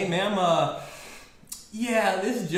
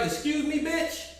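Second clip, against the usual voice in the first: high-pitched incredulous replay; high, offended retort, not shouted; mock indignant; 9 semitones higher, 4 dB quieter than usual. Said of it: "Literally, just like".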